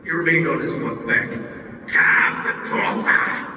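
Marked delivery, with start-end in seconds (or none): 1.9-3.5 low, slow, breathy-whispered lure; creepy luring monster. Acted. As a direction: coax the victim in, sinister. Boss lures Carl into pouch.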